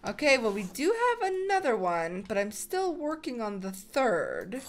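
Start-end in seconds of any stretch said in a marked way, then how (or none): none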